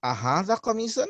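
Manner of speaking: أهذا قميص with a rising close; quick rising question. Shown as a demonstration. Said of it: "we say, for instance".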